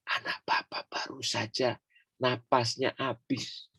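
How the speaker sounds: whispered bad news. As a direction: breathe it out gently, barely voiced. Bote flüstert die Todesnachricht.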